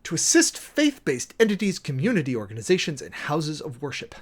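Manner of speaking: measured, official reading of an executive order; formal document reading. Acted. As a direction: read it out like a clerk entering it into the record. Reads order text again.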